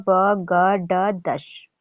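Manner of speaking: ब ग ड दश very slow, sound by sound; careful pronunciation. Shown as a demonstration. Teacher asks students to mind each sound of ब ग ड दश. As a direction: spell out ब ग ड दश slowly. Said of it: "ध्यान दीजिएगा".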